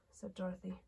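ordinary delivery

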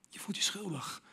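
breathy guilty whisper. Acted. Preacher whispering the feeling of guilt. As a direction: let it fall to a whisper.

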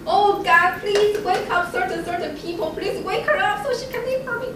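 high, loud urgent plea to God; pleading prayer. Retold or imitated. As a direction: beg God for help.

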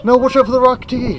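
loud urgent high shout; shouted warning. Real, not acted. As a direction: yell a warning at her. Warning child about rock.

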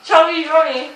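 words mushed by full cheeks; cheeks stuffed full. Real, not acted. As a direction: mouth crammed with marshmallows. More marshmallows stuffed in.

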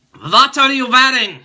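put-on high, loud, accented joke voice; creepy caller voice. Mock creep asking the viewer what they wear. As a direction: ham it up like a creepy prank caller. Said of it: "No, I'm just kidding".

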